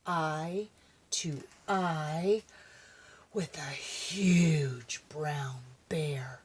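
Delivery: slow, hushed whisper; suspense whisper. A narrator's reading. Narrator reveals the bear in a slow whisper. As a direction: draw it out, spooky and hushed.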